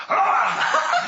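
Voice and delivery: high, loud mock shriek to startle; retold scare shriek. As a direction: jump out with a shrill playful scream. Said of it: "she grabs my leg and goes, this".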